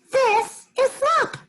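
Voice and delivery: very high, slow, proud squeal; high squeaky pig voice, pleased with himself; proud Piggy. Acted. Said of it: "He's proud of himself".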